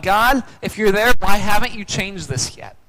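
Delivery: shouting at God. Retold or imitated. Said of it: "makes me shout out".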